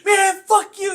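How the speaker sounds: high, loud defiant yell. Retold or imitated. Little brother yelling back at the crackhead. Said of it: "he's like".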